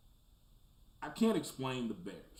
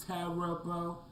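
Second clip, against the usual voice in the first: higher, drawn-out Cousin Vinny Mona Lisa impression; a quick Marisa Tomei send-up, pitched up; 5 semitones higher, slower than usual. Cousin Vinny Marisa Tomei bit on his bad offseason, mock impression. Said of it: "In my Marissa Tomei voice".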